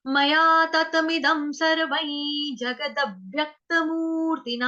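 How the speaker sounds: whole line intoned slowly; slow recitation. Shown as a demonstration. Teacher recites the whole first line for students, slow chant. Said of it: "पहला चरण देखेंगे पूरा".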